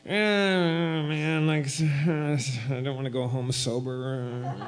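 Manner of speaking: put-on drawn-out, soft drunken whine; mildly hammed-up reluctant moan; miserable mumble. Upset friend at the bar won't go home, miserable mumble.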